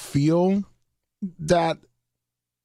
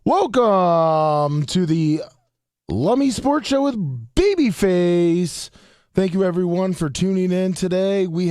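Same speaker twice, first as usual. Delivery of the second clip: upbeat host intro, slowed; bright show opener, drawn out; announcer voice; 5 semitones higher than usual. Acted. Opening the radio show.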